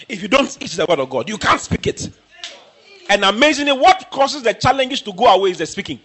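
ordinary talk

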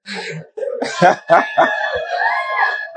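high-pitched burst of laughing; real laughter. Real, not acted. Preacher after his funeral anecdote, real high laughter. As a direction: crack up at your own story.

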